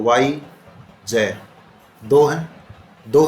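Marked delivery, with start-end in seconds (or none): 0.0-1.4 wise split in two, वाई, then जय after a pause; two separate beats, वाई … जय; broken into syllables. Shown as a demonstration. Teacher counting syllables in wise for students, वाई and जय said apart. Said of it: "wise word को बोलो".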